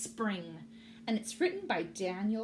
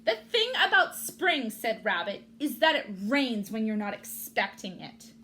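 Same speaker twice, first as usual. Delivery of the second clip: grumpy rabbit; 7 dB louder than usual. Acted. Rabbit complains.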